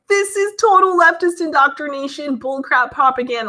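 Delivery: high-pitched, indignant mock rant; exasperated outburst. Reacting to an old eighties sitcom, exasperated. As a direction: start high and step down in exasperation.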